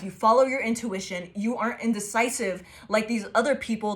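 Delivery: usual speaking voice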